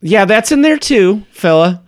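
elf woman, exasperated. Acted. Elf answers rudely.

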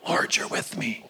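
whisper. Acted. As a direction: whisper it like a private prayer. Repeats line, then whispers.

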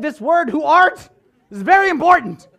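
hammed-up solemn weight on every word; emphatic preaching. Preacher re-enacting his mistaken sermon, insistent. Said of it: "giving them the business".

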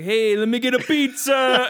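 put-on loud, drawn-out hammy Italian accent; mock Italian accent. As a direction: put on a big, high, slow Italian accent. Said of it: "if you called an Italian guy".

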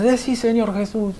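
high-pitched quoted urging; retold insistent command. (orden insistente imitada, aguda)

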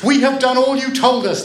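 put-on high, loud children's shout; calling out. A narrator's reading. Children calling from window to the girl, loud. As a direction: call out with childish urgency. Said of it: "the children called".

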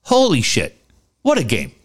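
ordinary talk, nothing marked

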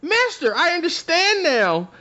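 very high, eager little voice; familiar's voice. Retold or imitated. Narrator voices the familiar thanking her master, very high and eager. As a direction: pitch it way up with childlike gratitude. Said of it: "And she goes".

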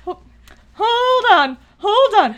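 high, loud pleading cry; excited plea. Retold or imitated. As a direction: beg them to hold on, breathless. Excited plea during force scene.

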